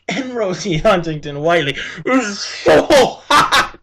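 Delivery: slow, laughing, drawn-out; laughing gush. Real, not acted. Vlogger gushing to viewers about an actress, laughing. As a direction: laugh through it as you gush.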